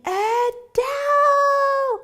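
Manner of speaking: long, high shout of a name across a passage; a far-off excited scream. Retold or imitated. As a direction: call her name out loud and stretched. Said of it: "someone down the passage screaming".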